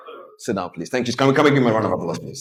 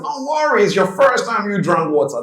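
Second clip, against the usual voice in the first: high, breezy acted reply; high-pitched reassurance; 7 semitones higher than usual. Speaker mimics himself reassuring his tipsy friend, high-pitched reassurance. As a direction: brush off the worry in a high voice.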